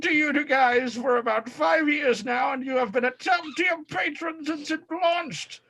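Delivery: put-on Aussie accent; Australian accent. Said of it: "This is an interesting Australian accent".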